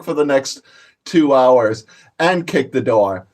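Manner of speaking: normal talking voice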